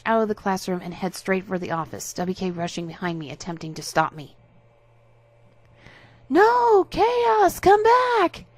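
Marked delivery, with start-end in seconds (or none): 6.3-8.4 very high, loud pleading call; desperate calling out. Acted. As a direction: call after her as she leaves. Harasser calls after her.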